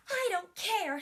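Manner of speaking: loud, high scoff. Acted. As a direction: brush mum off like a defiant child. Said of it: "scoffed the princess".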